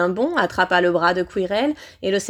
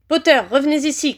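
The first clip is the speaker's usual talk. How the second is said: raised, higher-pitched command; shouted order; 6 semitones higher, 4 dB louder, faster than usual. A narrator's reading. Villain calls the fleeing boy back, shouting. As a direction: call the boy back sharply. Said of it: "cria Quirrell".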